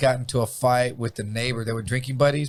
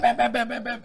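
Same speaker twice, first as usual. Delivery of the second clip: higher mock bangs; imitated banging; 8 semitones higher than usual. Telling friends how the banging came back, mock bangs. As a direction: hammer the door sounds out.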